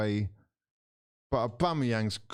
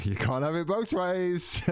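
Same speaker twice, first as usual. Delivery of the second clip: high laughing repeat of the line; 9 semitones higher than usual. Real, not acted. Repeating his own jab to the chat, laughing. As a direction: laugh through it, pitched up.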